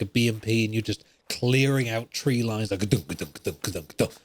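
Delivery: ordinary delivery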